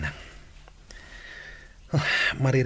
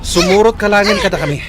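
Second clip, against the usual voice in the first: loud, higher, forceful; rough order; 9 semitones higher, 10 dB louder than usual. Acted. Captor forces Luningning to come, loud rough order. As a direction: order her to come along.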